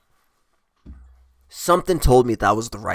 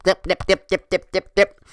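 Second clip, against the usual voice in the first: staccato, higher-pitched dips in even beats; Pac-Man; 6 semitones higher than usual. Retold or imitated. Teller mimicking her swerving car as a video game, imitated blips. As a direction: imitate an arcade game's chomping blips. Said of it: "like, fucking Pac-Man".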